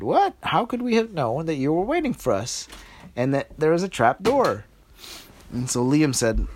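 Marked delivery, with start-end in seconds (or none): 0.0-4.6 high, astonished question; surprised princesses. Acted. Princesses amazed at Liam's secret, high and surprised. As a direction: gasp at the news. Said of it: "the princesses said".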